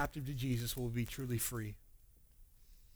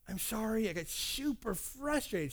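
high, softened, pleading apology; acted apology; 5 semitones higher, 5 dB quieter than usual. Husband apologising to wife after yelling, pleading. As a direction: plead it in a higher, gentler voice.

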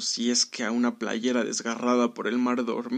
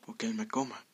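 whisper; 9 dB quieter than usual. A narrator's reading. Jeremías doubts his own battle cry, in a whisper. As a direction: drop to a whisper, undercutting the boast. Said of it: "susurró".

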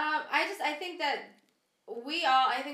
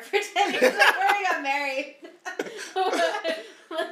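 laughing; 7 semitones higher, 9 dB louder than usual. Real, not acted. Laughing about dating ten years.

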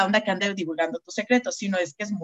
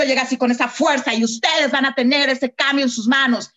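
higher, louder and forceful like a yellow; forceful yellow energy; 4 semitones higher, 5 dB louder than usual. Shown as a demonstration. Yellow type arriving at a talk, forceful and loud. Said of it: "Se dan cuenta del contraste".

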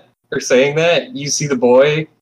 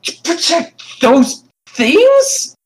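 put-on very high, slow child's voice; boy turns on the killers; disbelieving boy; 13 semitones higher, slower than usual. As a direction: draw it out in disbelief. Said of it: "the boy is gonna turn his head and say".

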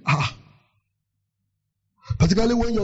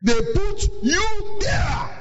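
slow, high shout of "put you there"; shouted slowly; 8 semitones higher, much slower than usual. Shown as a demonstration.

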